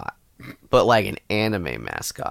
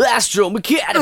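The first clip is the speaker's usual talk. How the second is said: anime shout; 11 semitones higher, 4 dB louder than usual. Pretend. Anime shouting game continues.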